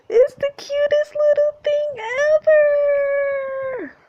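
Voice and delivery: loud, very high, drawn-out squeal; high wailing gush of delight. Acted. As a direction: squeal slowly over something adorable. Gushing over the revealed robot.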